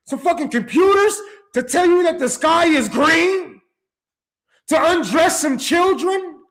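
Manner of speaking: rising into a furious shout; ranting shout at the people in charge; angry shout. Real, not acted. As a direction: yell it out in outrage. Heated rant about corporations.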